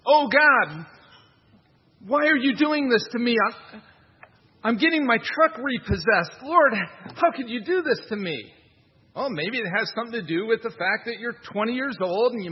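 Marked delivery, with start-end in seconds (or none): 0.1-8.5 high, loud mock lament to God; mocking a young man wailing at God; whiny complainer. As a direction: ham up a self-pitying prayer. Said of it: "blaming God for it".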